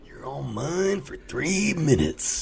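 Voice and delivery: high, slow gloating taunt; wrestler trash talk. Retold or imitated. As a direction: gloat over your prey slowly. Bonesaw taunting in ring.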